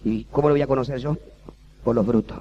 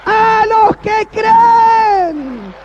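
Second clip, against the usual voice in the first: very loud, high, slow preacher's shout; shouted preaching; 11 dB louder, slower than usual. Acted. Pastor shouting faith to the congregation, very loud and high. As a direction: cry it out to the whole church.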